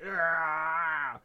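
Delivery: drawn-out strangling growl-yell; mock rage roar. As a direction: let out a hammed-up roar of fury. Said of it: "rage face".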